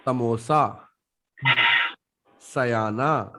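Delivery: "समोसा", "सयाना" with a forward-tongue s; clear S demo. Shown as a demonstration. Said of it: "when we speak in स". ("समोसा", "सयाना" में साफ़ स)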